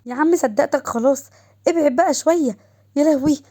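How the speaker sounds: playful wail of complaint; mild shrieking. A narrator's reading. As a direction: beg off from a losing game. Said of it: "وضحى كانت بتصوت".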